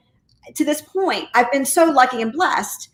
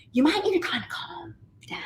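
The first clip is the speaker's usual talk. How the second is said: imitating a coach, dropping to a whisper; softly retold, trailing into a whisper; hushed advice; 6 semitones higher, 9 dB quieter than usual. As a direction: whisper it like delicate, awkward advice. Coach's tactful advice, acted out.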